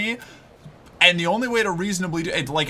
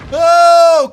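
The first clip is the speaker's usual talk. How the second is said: sudden loud startled "Oh"; startled yell; 12 semitones higher, 13 dB louder than usual. Real, not acted. Streamer yelling at the game, startled. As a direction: yelp as the enemy hits.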